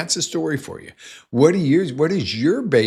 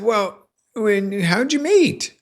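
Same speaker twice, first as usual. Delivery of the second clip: high, playful and a bit louder; teasing leading question; 12 semitones higher, 4 dB louder, slower than usual. Retold or imitated. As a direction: lure her into the answer with a sly lilt. Quoting his own leading question.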